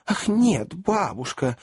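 put-on high girlish exclamation; crying out. A narrator's reading. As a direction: call out in high-pitched protest as a young lady. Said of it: "крикнули обе юные леди".

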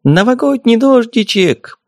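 high, slow sing-song fairy cry; fairy's shout. A narrator's reading. The fairy flies off shouting her sparkle spell, high sing-song call. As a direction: call it out while flying away. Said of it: "полетела дальше с воплем".

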